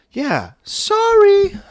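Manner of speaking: out of breath. Real, not acted. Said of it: "I'm out of breath".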